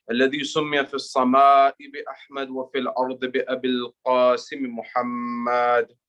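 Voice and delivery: brisk, low chanted Arabic recitation; recited Arabic invocation. Real, not acted. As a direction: rattle off the opening prayers in a low chant. Opening Arabic invocation before sermon.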